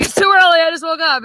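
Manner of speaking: high, loud it's too early; hammed-up grumpy husband. Wife mocking husband's morning grumpiness, high and loud. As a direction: grumble it up high like a whiny man. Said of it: "He's like".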